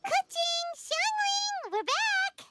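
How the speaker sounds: high, carrying call to friends across the room; calling friends back home. Real, not acted. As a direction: sing out their names cheerfully on arrival.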